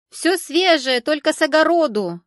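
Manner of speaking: loud, high-pitched market cry; hawker's bawling. A narrator's reading. Greens seller waving bunches at shoppers, high loud bawling. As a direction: bawl it out over the stalls. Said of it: "продолжала голосить женщина".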